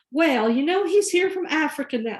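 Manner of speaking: high-pitched imitation of a Southern woman; Southern drawl. Elderly woman answering the doctor's phone, imitated Southern drawl. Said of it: "in this very deep Southern drawl".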